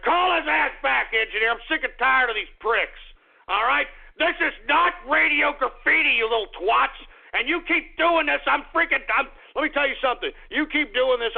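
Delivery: high-pitched, heated tirade; fed-up angry rant. Real, not acted. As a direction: lose your temper at prank callers. Fed up, rants at prank callers.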